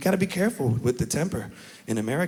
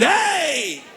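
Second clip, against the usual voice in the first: excited held-out hey; 12 semitones higher than usual. Retold or imitated. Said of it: "we hold it out".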